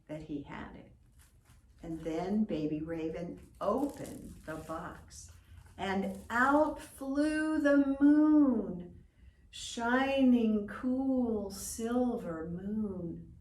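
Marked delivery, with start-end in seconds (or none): 5.8-13.3 slow, louder, full of wonder; wondrous telling. A narrator's reading. Narrator tells the children of the stars, in wonder. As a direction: marvel as the stars fly out.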